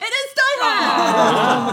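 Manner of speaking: loud, high, drawn-out cry of triumph; excited shout. Real, not acted. Quiz host cheering a correct guess, loud and excited. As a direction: yell the right answer in delight.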